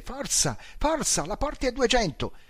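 high thrilled "forza, forza"; urging the driver on, high; excited urging. Acted. Passenger urging past 190.

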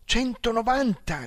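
high thrilled shout; thrilled shout, slowed; shouting the speed. A narrator's reading. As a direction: call out the number in delight. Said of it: "gridò il mio passeggero balzando sul sedile".

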